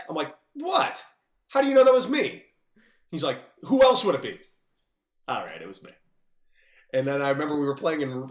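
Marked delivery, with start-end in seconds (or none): 0.5-2.4 high, loud fake innocence; mock surprise. Feigned innocence about locker prank. 3.6-4.4 a loud, high who-else comeback; loud retort. Retold or imitated. Quentin's dry retort.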